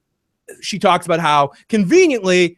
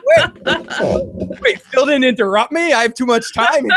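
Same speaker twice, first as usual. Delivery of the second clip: high, puzzled mock voice; mock surprise; 7 semitones higher, slower than usual. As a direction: act startled at the silence.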